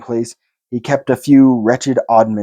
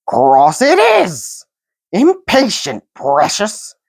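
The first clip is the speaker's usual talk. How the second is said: slow, high hissing scold; hissed; 7 semitones higher, slower than usual. A narrator's reading. Gollum hissing about impatient Bilbo. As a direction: hiss the complaint to his precious. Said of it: "hissed Gollum".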